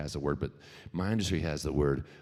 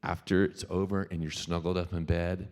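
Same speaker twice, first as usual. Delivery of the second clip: ordinary talk, nothing marked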